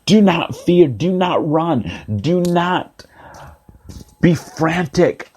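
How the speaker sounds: high, insistent exhortation, slowing down; calling out. Acted. God calls out to the scared, high insistent call. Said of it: "God is calling out to us".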